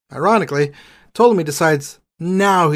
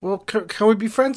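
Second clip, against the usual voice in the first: put-on high, pleading boy-king voice; whiny Ptolemy; 6 semitones higher, 4 dB quieter than usual. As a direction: plead like a clueless preteen.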